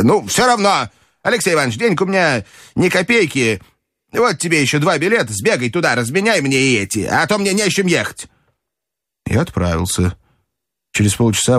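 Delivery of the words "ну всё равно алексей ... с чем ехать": put-on high-pitched old-lady character voice; brisk grandmother. A narrator's reading. Grandmother sending her companion for money, high and brisk. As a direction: give errands as a bossy old lady. Grandmother sends narrator to change tickets.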